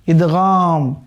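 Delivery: ordinary delivery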